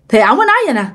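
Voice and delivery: ordinary delivery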